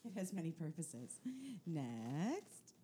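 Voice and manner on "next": ordinary talk, nothing marked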